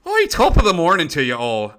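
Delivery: a glitching gadget blaring a cartoon Irish greeting; loud mock Irish brogue from a broken voice changer; faulty Irish voice modulator. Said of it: "the voice modulator".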